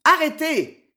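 loud, high booming shout; one big, high-pitched roar; stentorian voice. A narrator's reading. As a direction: bellow it like a herald. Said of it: "répéta le moine d'une voix de stentor".

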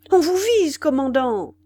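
high, loud cry of alarm; shouted warning. A narrator's reading. Gunners warning their commander he is being aimed at, shouted. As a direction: call out an urgent warning. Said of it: "crièrent les artilleurs".

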